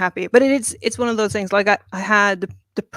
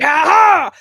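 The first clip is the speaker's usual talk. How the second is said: louder, higher mock triumphant shout; hammed-up cry of triumph; triumphant aha; 7 semitones higher, 11 dB louder than usual. Cocky author strides into the store, mock triumphant shout. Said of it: "I was just like".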